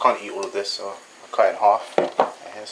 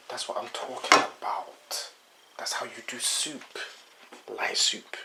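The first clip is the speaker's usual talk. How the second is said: soft breathy whisper; 6 dB quieter than usual. Real, not acted. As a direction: whisper it with relish. Savoring the finished soup.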